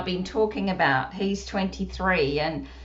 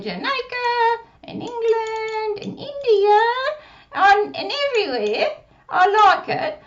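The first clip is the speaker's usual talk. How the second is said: put-on puppet child voice; 7 semitones higher, 5 dB louder than usual. Puppet Susie speaking.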